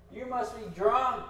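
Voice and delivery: high, loud mock accusation; upset priest. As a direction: imitate a priest's indignant outburst. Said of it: "He's upset. He looks at this woman".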